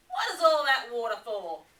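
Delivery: loud, quick curious Platypus; a lightly voiced Platypus, quick and loud; Platypus asking. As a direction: fire the question out as Platypus. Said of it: "he said, snapping his bill".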